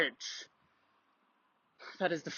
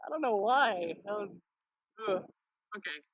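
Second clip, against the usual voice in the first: ordinary talk, nothing marked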